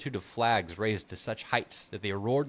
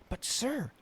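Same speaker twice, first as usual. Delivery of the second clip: put-on young squire voice; 13 semitones higher, 5 dB quieter than usual. Squire pleads in read story.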